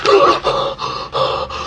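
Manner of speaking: long, ragged gasp of horror; shocked gasp. Acted. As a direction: gasp in hammed-up disbelief. Shocked by the grade.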